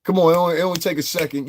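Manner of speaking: high, loud taunt; mock taunting shout. Streamer taunting a chat troll, loud mock taunt. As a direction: egg him on, loud.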